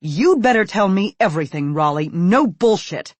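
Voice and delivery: loud, enraged order, slowing on the last words. Acted. Enraged mother demands the whole truth from her son. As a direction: lay down the law, no excuses. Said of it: "with an enraged look".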